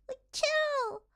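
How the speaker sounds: high, drawn exasperated plea. Pretend. Streamer pleading with outraged fans, playfully exasperated. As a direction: beg them to ease up, voice pitched high.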